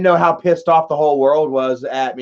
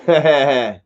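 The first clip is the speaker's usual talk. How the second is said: brief laugh after a pause; short laugh; 3 semitones lower than usual. Real, not acted. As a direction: laugh briefly at your own story. Own laugh after the story.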